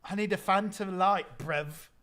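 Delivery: light mock British accent; imitating British slang. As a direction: copy the British clip, lightly puzzled. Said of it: "why is he saying brev".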